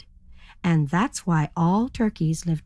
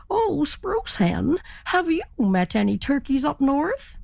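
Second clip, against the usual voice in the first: inquiring turkey; 7 semitones higher than usual. A narrator's reading. Turkey hails a spruce hen, high questioning. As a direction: stretch the name, lift the question.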